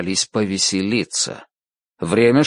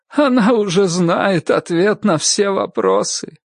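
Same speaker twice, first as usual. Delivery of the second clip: higher, choking on tears; blubbering; 9 semitones higher than usual. A narrator's reading. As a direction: sob the words out, higher. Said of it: "захлёбываясь слезами".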